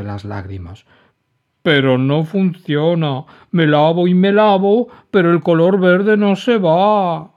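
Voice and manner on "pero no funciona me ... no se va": whiny refusal. A narrator's reading. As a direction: insist through tears. Dragon despairs.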